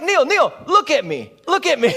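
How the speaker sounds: won't take no for an answer, shouting; insistent hype. Retold or imitated. Tall teammate insisting to Neil he meant it, loud and high. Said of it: "He's like".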